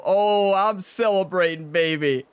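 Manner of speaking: excited. Real, not acted. Celebrating near ace.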